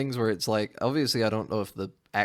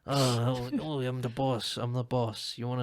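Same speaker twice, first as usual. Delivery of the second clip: mock strange accent, slightly higher; Tom Hardy; 4 semitones higher than usual. Mocking a biker gang leader claiming charge, in a strange accent. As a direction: stammer it in a put-on odd accent. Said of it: "He's got such a strange accent".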